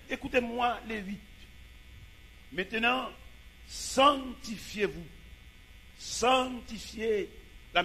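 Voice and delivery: dramatic Bible reading. Acted. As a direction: proclaim King Hezekiah's words with grandeur. (lecture biblique déclamée avec force)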